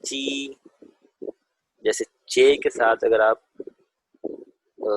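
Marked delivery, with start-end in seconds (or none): 0.0-0.2 چی said with a light ch and a thin ee; crisp چی, with the tongue forward; Chinese j sound. Shown as a demonstration. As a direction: model چی for the class to repeat. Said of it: "اس کو پڑھیں گے".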